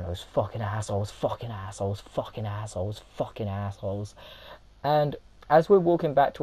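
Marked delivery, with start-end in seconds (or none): 0.0-4.1 muttered curse. Retold or imitated. Stranger cursing them.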